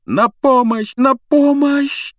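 high, loud, drawn-out cry for help; desperate plea. Acted. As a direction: beg for rescue in panic. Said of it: "отчаянно шептала Гермиона".

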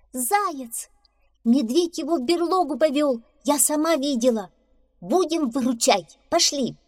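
high, urgent calling; rally a friend to come. A narrator's reading. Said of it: "позвала белка барсука". (зовёт громко)

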